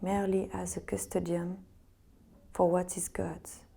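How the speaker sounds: meditative reading in French-accented English. Real, not acted. Yoga teacher reading Vivekananda to resting students, French-accented English. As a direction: read it calmly with a French accent, pausing between phrases. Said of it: "Je m'excuse d'avance pour mon accent".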